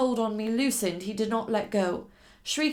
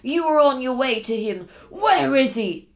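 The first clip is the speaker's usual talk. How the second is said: menacing demand; 3 semitones higher, 9 dB louder than usual. Acted. Captor threatening at knifepoint.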